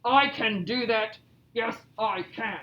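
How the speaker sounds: slow, emphatic bear voice; boastful Bear. Acted. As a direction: play a bragging storybook bear, pitched a touch low. Reads Bear's boastful lines aloud.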